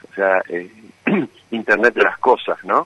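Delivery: ordinary talk, nothing marked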